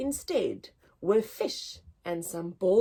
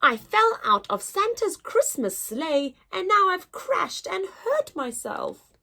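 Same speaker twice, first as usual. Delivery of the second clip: high, louder little elf voice; a tiny elf popping up, pitched high and bright; little elf crying out; 8 semitones higher, 4 dB louder than usual. Acted. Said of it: "burst out and cried".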